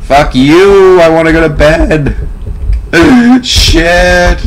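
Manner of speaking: high-pitched fed-up cry; frustrated outburst. Real, not acted.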